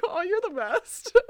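mock lovestruck gush; gushing through laughter. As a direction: swoon and laugh at once. Own response imitated, high, laughing.

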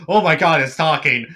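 jokey, startled outburst; freaking out. Acted. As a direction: blurt it out in hammed-up shock. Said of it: "him freaking out".

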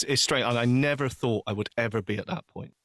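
normal talking voice

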